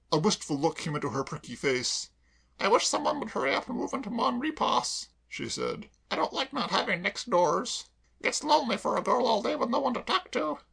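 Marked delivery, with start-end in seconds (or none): 2.6-5.1 high, chirpy cockney maid voice; perky maid reassuring her mistress; Claire the maid. Acted. Claire wistful. 6.1-10.6 high, chirpy cockney maid voice; perky maid reassuring her mistress; Claire the maid. Acted.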